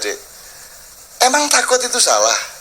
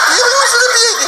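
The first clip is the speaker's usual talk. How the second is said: laughing shout. Acted. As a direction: burst out laughing in disbelief. Mocking the brave parent. (teriakan tertawa dengan nada tinggi)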